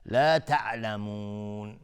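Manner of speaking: تعلمون slow, its final oo held long; lengthened stop. Shown as a demonstration. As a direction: stretch the last vowel of تعلمون before stopping. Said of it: "مد عارض للسکون".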